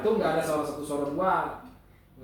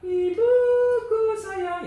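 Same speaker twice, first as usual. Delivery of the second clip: sung very high and louder; original key; 9 dB louder, much slower than usual. Shown as a demonstration. Teacher showing the original key to the class. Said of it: "Kalau nada dasar aslinya kan".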